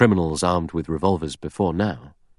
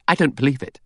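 higher, disbelieving; incredulous; 8 semitones higher than usual. A narrator's reading. Vera to the men, incredulous. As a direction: refuse to believe it. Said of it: "Vera said incredulously".

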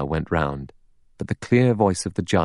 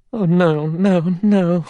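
a high, anguished moan of denial; moaning outburst; 12 semitones higher, 4 dB louder, slower than usual. A narrator's reading. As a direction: burst out, pitch leaping up, half moaning. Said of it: "burst out, almost in a moan".

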